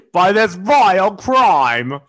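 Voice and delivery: loud, high, drawn-out mock British; British accent. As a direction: hammer it slowly in an overblown British accent. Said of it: "I'm not good at a British accent".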